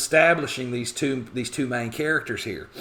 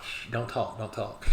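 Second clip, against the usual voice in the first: soft hushed warning, much quieter; hushing; 8 dB quieter than usual. Retold or imitated. Husband hushing Hester from the crowd, quiet hushed voice. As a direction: hush her quietly so nobody hears. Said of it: "He's like".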